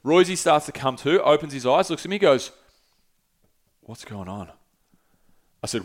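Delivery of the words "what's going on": very soft, low, dazed murmur; faint, low voice of a man waking up; groggy coming-to. Retold or imitated. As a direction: imitate someone just regaining consciousness. Friend coming to after choke-out.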